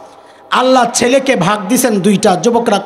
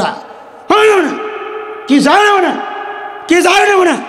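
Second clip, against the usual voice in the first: put-on petulant child's protest; 6 semitones higher than usual. Child refuses the hundred taka.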